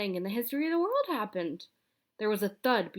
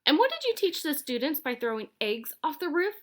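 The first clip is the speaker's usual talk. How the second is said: high, accusing demand; high accusing old lady; angry shout; 7 semitones higher, 4 dB louder than usual. A narrator's reading. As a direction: demand an answer furiously. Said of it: "shouted Missus Mintry".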